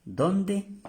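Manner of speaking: high asking "¿dónde?"; questioning where. Shown as a demonstration. Teacher acting out asking where. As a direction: ask "dónde" like a real question. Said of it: "aquí ya estamos preguntando".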